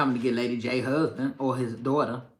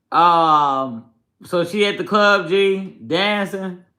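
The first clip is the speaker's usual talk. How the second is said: higher, louder, slow gossip; excited gossip; 5 semitones higher, 6 dB louder, much slower than usual. Real, not acted. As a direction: spill the tea excitedly.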